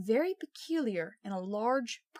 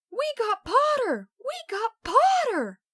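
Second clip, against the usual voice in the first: much higher, loud, slowed cheering yell; twins yelling; 13 semitones higher, 9 dB louder, slower than usual. A narrator's reading. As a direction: crow it out in triumph. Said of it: "the Weasley twins yelled".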